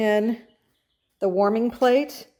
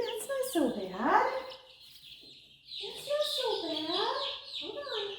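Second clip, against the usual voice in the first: high, soft soothing coo; 11 semitones higher, 8 dB quieter than usual. Real, not acted. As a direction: reassure the chicks gently. Soothing chicks.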